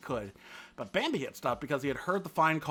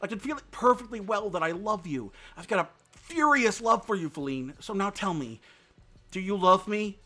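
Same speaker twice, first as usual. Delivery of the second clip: louder, higher urgent pleading; insisting; 6 semitones higher, 6 dB louder than usual. A narrator's reading. Bambi urging Feline to admit her love, insistent. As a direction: press her, heart racing. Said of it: "Bambi insisted".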